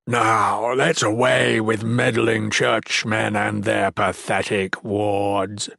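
slow, drawn-out villain voice; menacing monster villain. A narrator's reading. Monster scorning the churchmen, slow menacing contempt. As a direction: dismiss them as a contemptuous monster.

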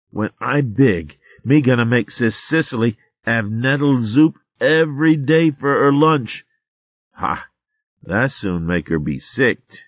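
a tiny rascal vowing revenge; lightly voiced cheeky babe; boastful squirrel babe. Arvin boasts about revenge.

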